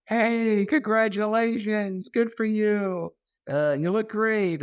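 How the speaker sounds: high, bubbly praise; cheery flight attendant. Retold or imitated. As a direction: greet him like a fan. She spots me and goes.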